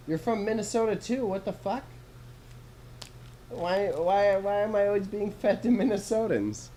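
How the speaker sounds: high-pitched, incredulous questioning; baffled by the coincidence, voice pitched right up; amazed and stammering; real disbelief. Real, not acted. Amazed at another Minnesotan viewer.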